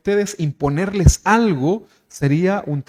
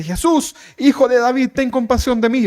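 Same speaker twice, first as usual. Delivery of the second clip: high, insistent cry for mercy; pleading shout; 7 semitones higher, 4 dB louder than usual. Acted. Blind Bartimeo begs Jesús for compassion, high pleading shout. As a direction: call out urgently over a crowd. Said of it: "te ponías a gritar como loco".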